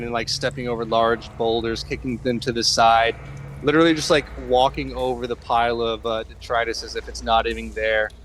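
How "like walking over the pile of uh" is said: ordinary delivery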